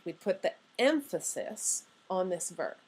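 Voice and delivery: ordinary talk, nothing marked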